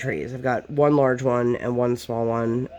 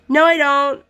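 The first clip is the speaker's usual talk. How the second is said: put-on high, loud defiant little kid; defiant child; 11 semitones higher, 6 dB louder than usual. Daughter refusing to wait for the food, mock defiant child. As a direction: mimic a stubborn toddler talking back. Daughter defying mom, retold.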